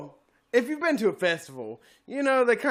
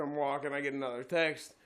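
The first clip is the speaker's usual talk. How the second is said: ordinary talk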